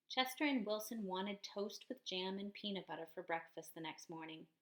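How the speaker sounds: plain reading voice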